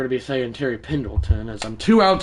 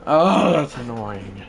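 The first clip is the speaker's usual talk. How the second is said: ordinary talk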